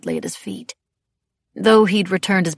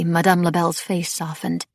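ordinary delivery

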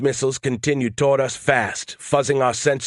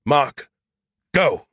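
mild shout, pitched up; shouted command; 3 semitones higher than usual. A narrator's reading. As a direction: call the go signal sharply, without full volume. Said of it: "Skippy shouted".

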